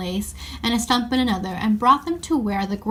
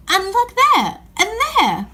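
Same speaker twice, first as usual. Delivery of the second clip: amazed; 10 semitones higher, 5 dB louder than usual. Acted. Amazed at the wood appearing.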